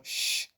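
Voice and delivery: "sh" alone as a soft, voiceless hush; isolated sh sound. Shown as a demonstration. Teacher picking the sound out of Osho, soft hushed "sh". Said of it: "You can hear it there".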